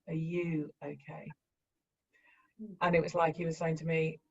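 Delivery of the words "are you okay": softer and slower, gently handing the question back; soft slow echo. Retold or imitated. The son in the dream answering his mother, a soft slow echo. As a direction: echo it quietly and slowly, turning it back on her. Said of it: "and he went".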